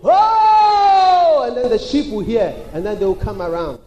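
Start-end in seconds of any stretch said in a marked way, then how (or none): 0.0-1.4 long, high, loud holler; drawn-out herding cry; shepherd's call. Acted. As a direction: call the sheep across a far field. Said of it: "the voice of the shepherd will go".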